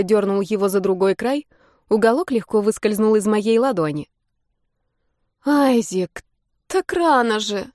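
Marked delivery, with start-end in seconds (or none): 5.4-7.7 groaning. A narrator's reading. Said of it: "простонала".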